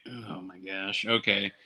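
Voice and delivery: soft, understated "here we go again" resignation; resigned acceptance. Retold or imitated. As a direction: give in quietly, under your breath. Reluctantly accepts wife's peacock plan.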